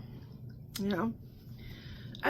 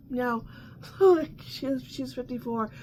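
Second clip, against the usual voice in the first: ordinary delivery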